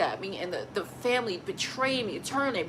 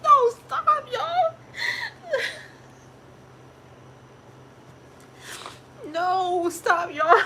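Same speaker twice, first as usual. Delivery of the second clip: much higher, loud crying laugh; 8 dB louder, much slower than usual. Real, not acted. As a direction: break between sobbing and laughing. Overwhelmed laughing-crying at question.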